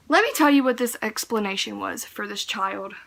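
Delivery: ordinary talk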